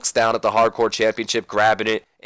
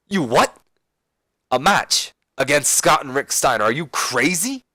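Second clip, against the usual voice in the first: shocked disbelief; 5 semitones higher than usual. Retold or imitated. Kanyon shocked at partner's news.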